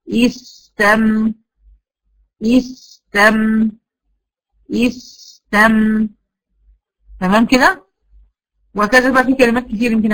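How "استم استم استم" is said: استم with the س stretched very long; held sin demo. Shown as a demonstration. Teacher repeats the syllable to time the letters, very slowly. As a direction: hold the س far longer than the م. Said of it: "هخلي زمن السين اطول".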